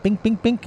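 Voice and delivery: imitated clicking. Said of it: "he went".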